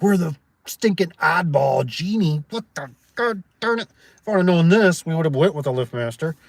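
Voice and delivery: hammed-up griping about the oddball door brand; exasperated grumble. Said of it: "And I'm gonna be like".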